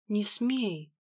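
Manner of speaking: scared, hushed plea; frightened whisper. Acted. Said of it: "испуганно прошептала Леона".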